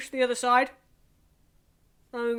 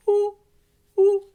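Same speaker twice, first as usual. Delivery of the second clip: owl hoot; 8 semitones higher, 8 dB louder than usual. Shown as a demonstration. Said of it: "Nice owl".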